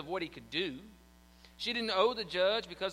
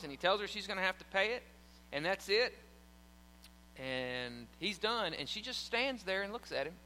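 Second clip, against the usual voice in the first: ordinary talk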